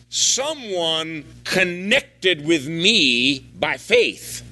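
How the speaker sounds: drawn-out slow, faith lifted high at the end; slow emphatic. Shown as a demonstration. Preacher reprising Jesus, slow and emphatic. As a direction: stretch it, land on faith. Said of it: "preacher reprises the quote".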